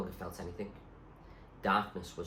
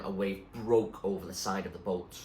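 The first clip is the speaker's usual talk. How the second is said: ordinary delivery, nothing marked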